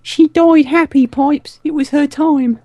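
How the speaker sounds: put-on high, slow childlike mayor; young child voice. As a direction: speak like a little kid. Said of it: "like a young child".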